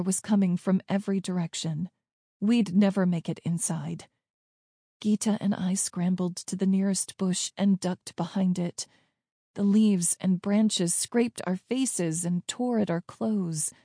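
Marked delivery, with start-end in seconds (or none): none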